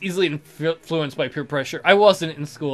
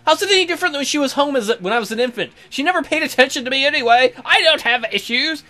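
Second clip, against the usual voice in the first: put-on high, fast whiny kid voice; mock child voice; 9 semitones higher, 4 dB louder, faster than usual. Gamer mocking his character, in a high fast kid voice. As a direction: play the bratty kid, quick and pitched up.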